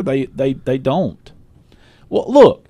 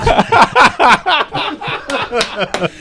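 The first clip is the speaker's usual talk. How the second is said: long high-pitched laugh; 13 semitones higher than usual. Real, not acted. Group laughing at banter.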